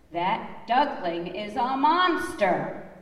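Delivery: a mock-outraged shriek; neighbor shrieking. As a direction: neighbor duck splashed with water, mock shriek.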